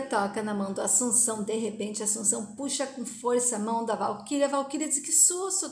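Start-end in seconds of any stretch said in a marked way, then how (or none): 5.0-5.7 startled. A narrator's reading. Narrated character startled by sudden pull.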